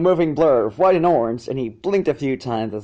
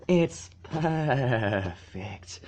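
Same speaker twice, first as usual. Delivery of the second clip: slow, soft and blissful; 5 dB quieter, much slower than usual. Acted. Filly basking in dragon smoke, slow and blissful. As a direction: melt into the word perfect. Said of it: "She managed blissfully".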